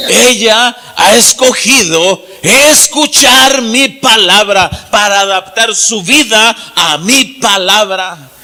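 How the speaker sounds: very slow, loud proclamation; preaching shout. Acted. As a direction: call it out to the whole crowd.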